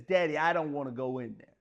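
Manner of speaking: ordinary talk, nothing marked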